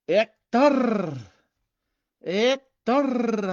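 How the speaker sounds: loud, high call across the room. Real, not acted. Greeting viewer Hector on stream, calling out loudly. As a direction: shout his name to get his attention.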